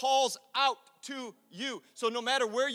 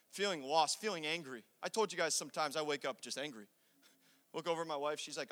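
ordinary talk

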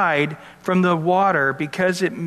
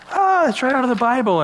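a mock excited believer, higher and a bit faster; 6 semitones higher, faster than usual. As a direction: play a thrilled believer spotting it in the Bible. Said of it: "believers are going".

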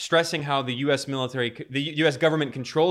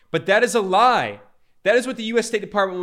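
louder and higher, flatly calling it a lie; indignant rebuttal; 6 semitones higher, 6 dB louder, faster than usual. Real, not acted. Host calling out the State Department to viewers, loud indignant. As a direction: hit it hard like a verdict.

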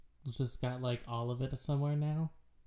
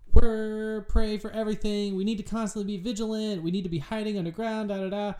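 meerkat song parody; 8 semitones higher, 8 dB louder than usual. Retold or imitated. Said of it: "songs of".

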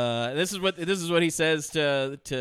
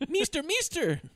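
put-on high, creaky old woman calling; old witch lady voice; 14 semitones higher, slower than usual. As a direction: call out like the Billy Madison witch lady. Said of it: "Air conditioner".

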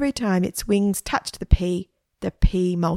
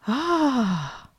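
long, higher-pitched sigh of wonder; awed gasp; 6 semitones higher than usual. A narrator's reading. Stephen seeing the glowing fly, awed gasp. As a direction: let out an airy gasp of awe.

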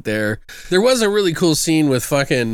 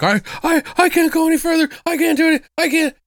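much higher, whimpering mock coward; whiny high panic; faster than usual. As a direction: whine like a scared coworker giving up. Mocks whining coworker in movie.

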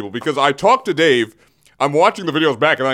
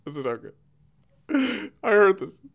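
normal talking voice

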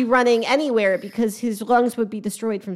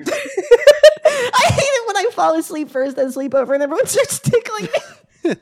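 high giggly whine; laughing kid voice; 10 semitones higher than usual. Pretend. Whiny kid voice mid-laugh.

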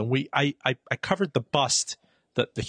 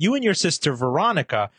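usual speaking voice

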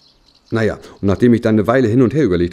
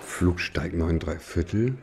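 puzzled; 5 semitones lower, 7 dB quieter, much slower than usual. Acted. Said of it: "Komisch, hab ich gedacht".

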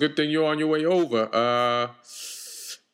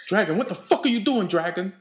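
scolding; 7 semitones higher than usual. Acted. Scolds dragon for biting.

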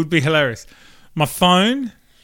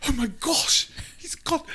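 mock panic; 11 semitones higher, 4 dB quieter than usual. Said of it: "they'd be like".

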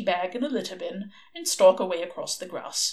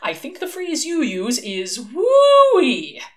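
bright, delighted collector; a high, slow, gleeful woo-wee at the end; pleased angel showing off his books; happy; 4 semitones higher, 6 dB louder, slower than usual. A narrator's reading. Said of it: "said Aziraphale happily".

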